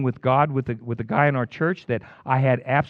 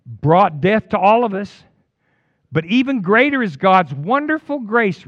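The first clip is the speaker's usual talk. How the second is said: scripture reading; 5 semitones higher, 4 dB louder than usual. Acted. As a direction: read it out like holy words.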